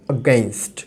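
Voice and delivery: "against" almost like "gainst", the a barely heard; weak opening vowel. Shown as a demonstration. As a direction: say "against" low, swallowing the first a. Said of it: "আটা কিন্তু শোনাচ্ছে না". ("against"-এর শুরুর আ প্রায় না শোনানো)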